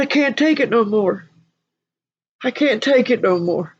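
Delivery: high, mildly anguished plea; imitating crying man. Michael saying he can't take it, imitated crying. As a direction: voice someone at breaking point, pitched high. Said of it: "He just cried and he's sobbing".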